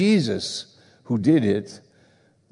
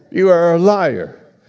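"You are a liar." called out loud and high; preacher thunders the accusation back at the room; shouted accusation; 7 semitones higher, 7 dB louder than usual. Acted. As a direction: let it ring through the hall, full force. Repeated accusation, now shouted.

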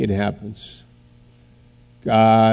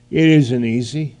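ordinary delivery

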